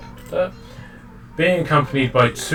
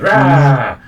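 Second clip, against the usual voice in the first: loud, high kitten roar; high, loud "rah"; tiny cat's roar; 3 semitones higher, 9 dB louder than usual. Acted. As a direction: let out a loud little battle cry as a tiny cat. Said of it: "he goes".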